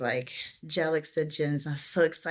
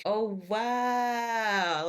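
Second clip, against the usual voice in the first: surprised; 5 semitones higher than usual. Retold or imitated. Stunned at nail salon refusal.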